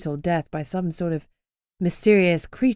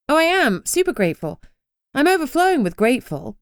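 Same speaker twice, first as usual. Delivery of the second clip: higher, louder, dripping sarcasm; sarcastic; 7 semitones higher, 3 dB louder than usual. Acted. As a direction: lay the sarcasm on thick. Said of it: "It came out more sarcastically".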